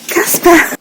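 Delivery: sharp gasp, then a loud, high call; gasping, high-pitched shout at a pet; startled shout. Real, not acted. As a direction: cry out the name in real alarm. Gasps, calling out to Casper.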